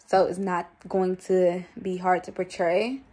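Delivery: ordinary talk, nothing marked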